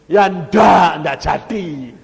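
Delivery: very loud, high, falling away; emphatic shouted denial. Real, not acted. Preacher answers whether he still went, shouting emphatically. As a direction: burst out with the refusal.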